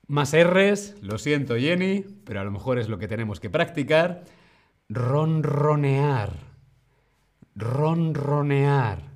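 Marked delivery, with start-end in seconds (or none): 4.9-9.0 slow ronronear with rolled R's; slow model word. Shown as a demonstration. Teacher modelling the word slowly for students. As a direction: stretch ronronear out, rolling each R. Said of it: "Repite conmigo".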